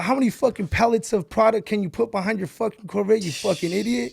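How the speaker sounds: a furious father chewing out his son; angry dad. Retold or imitated. Dad yelling at his son over the new Corvette, loud and outraged. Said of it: "he's like".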